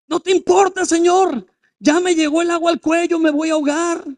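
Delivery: loud, high-pitched plea in acted panic; panicked cry. Disciples to Jesus as the boat founders, panicked cry. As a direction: cry out as if drowning.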